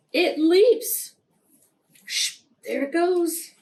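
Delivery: high, loud burst; spring the word out; excited reveal. A narrator's reading. Suspense payoff after pause.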